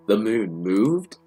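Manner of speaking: slow question, voice climbing on "moved"; startled disbelief. Acted. Teacher hears the moon has shifted, startled disbelief. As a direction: stretch it into an incredulous question. Startled reaction to bad news.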